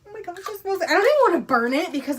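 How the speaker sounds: high, loud rush of delight; excited gushing. Real, not acted. Gushes over the candle smell.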